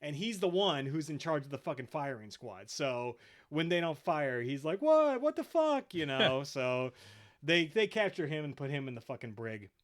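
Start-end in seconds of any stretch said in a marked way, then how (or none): 4.8-5.9 shrill shocked outburst, much higher and louder; a clone yelling at men who won't shoot; outraged disbelief. Retold or imitated. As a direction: squawk it in total disbelief. Dogma outraged at refusal to fire.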